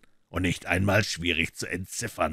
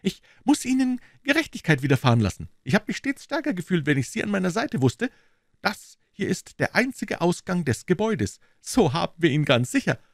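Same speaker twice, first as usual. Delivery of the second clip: high-pitched character voice; eager police detective; 11 semitones higher, 4 dB louder than usual. Acted. Detective acknowledging Holmes's help, high eager voice. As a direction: keep the detective's voice up high and earnest.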